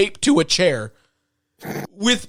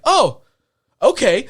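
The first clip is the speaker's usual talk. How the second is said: super nonchalant; 6 semitones higher than usual. Retold or imitated. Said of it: "Like, super nonchalant".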